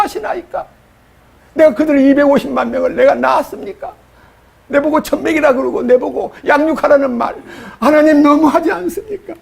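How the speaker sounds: high, aggrieved plea; pleading complaint. Retold or imitated. Moses telling God it's too much, high aggrieved plea. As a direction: push the grievance up high at God.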